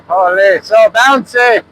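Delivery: high yell, stretched out slowly. Real, not acted. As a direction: call it out high over the engine. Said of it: "I don't know why I'm yelling".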